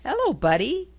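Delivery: ordinary delivery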